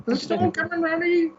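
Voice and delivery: very high mock old-lady voice. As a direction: squeak it out as a caricatured old woman. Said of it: "Yeah, the old lady".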